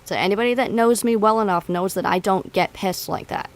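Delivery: loud, heated venting about a former friend; real angry rant. Real, not acted. Streamer ranting to chat about the friend who crossed her, loud real anger. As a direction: let the anger spill out, voice pushed loud. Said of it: "my anger levels are starting to go back up".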